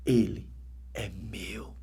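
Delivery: breathy, tearful whisper of a divine voice; barely voiced, almost weeping; a soft holy whisper holding back tears; hushed tearful whisper. Virgin Mary speaks to the mother.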